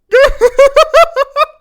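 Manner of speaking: very high, very loud cackle; loud panicked laugh. Real, not acted. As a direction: burst into a piercing laugh. Caught out, in trouble.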